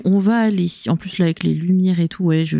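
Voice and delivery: normal talking voice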